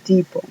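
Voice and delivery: Depot as "dipo", no final T; English word depot. Shown as a demonstration. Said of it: "la T que tiene al final no suena". ("Depot" sin T final)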